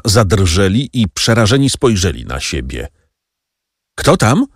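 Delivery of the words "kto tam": frightened husband shouts at a knock at the door. A narrator's reading. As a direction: call out in alarm. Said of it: "krzyknął Kadrus".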